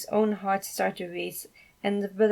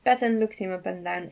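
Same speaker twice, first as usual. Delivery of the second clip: ordinary delivery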